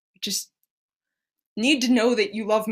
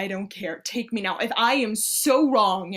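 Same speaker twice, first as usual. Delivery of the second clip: usual speaking voice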